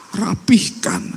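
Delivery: whispered word. Acted. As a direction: breathe the word out, voiceless. Weaver pleading to God. (berbisik lirih)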